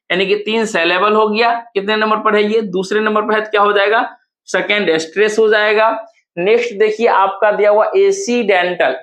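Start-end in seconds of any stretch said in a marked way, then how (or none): none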